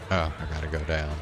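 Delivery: ordinary delivery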